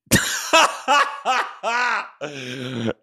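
herzhaftes Auflachen; lautes Lachen. Real, not acted. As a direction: lach los. Lacht über Versprecher. (lautes Auflachen)

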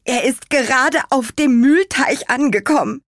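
high, loud, urgent report; blurted news. A narrator's reading. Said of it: "platzte Karottenwams ohne Umschweife heraus".

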